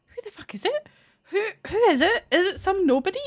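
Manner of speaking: high, frantic demanding who it is; excited disbelief. Retold or imitated. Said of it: "And I was just like".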